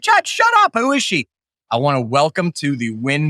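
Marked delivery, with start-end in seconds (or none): 0.0-1.3 hammed-up impatient shout that settles back down; impatient listener. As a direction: play the fed-up fan cutting the host off. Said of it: "You're like".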